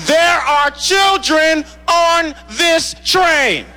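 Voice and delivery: a stern lady putting him in his place; scolding woman. Retold or imitated. Woman on the train scolding the heckler, a high slow shout. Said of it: "she turns around and she goes".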